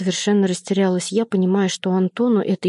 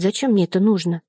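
plain reading voice

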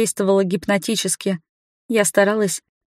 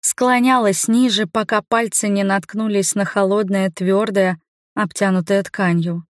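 ordinary delivery

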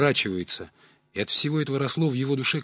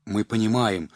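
plain reading voice

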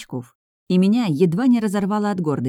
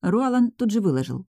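plain reading voice

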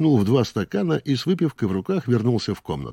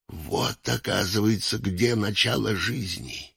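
usual speaking voice

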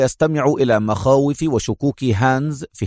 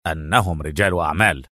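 low, emphatic announcement; restrained enthusiasm; 9 semitones lower than usual. A narrator's reading. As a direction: present the answer eagerly in a deep voice. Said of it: "هتف نيكولاس في حماس". (بحماس مكتوم)

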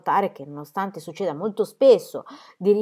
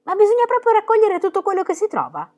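put-on much higher, child's questioning voice; a small girl asking timidly, pitched up; little girl asking; 13 semitones higher, 5 dB louder than usual. A narrator's reading. Annika asks Pippi about treasure hunting, high child voice. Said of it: "si informò Annika".

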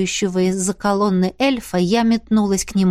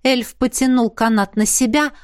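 plain reading voice

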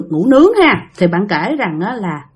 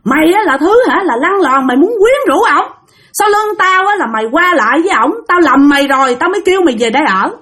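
angry accusation; 5 semitones higher than usual. Retold or imitated. Older sister accusing her younger sister of betrayal. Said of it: "chị hai của bạn nói rằng".

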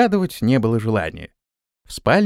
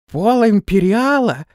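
surprised; 8 semitones higher, 3 dB louder, slower than usual. A narrator's reading. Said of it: "Брови Флаппия взметнулись вверх".

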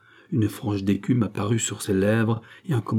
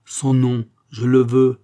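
loud, insistent order; imperious command; 3 semitones higher, 7 dB louder, slower than usual. A narrator's reading. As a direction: demand it with full authority. Gilbert commands despite resistance.

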